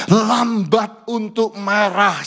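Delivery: drawn out word by word; slow for emphasis. Shown as a demonstration. Pastor teaching the verse, very slow stress. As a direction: lay each word down slowly. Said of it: "Semua berkata".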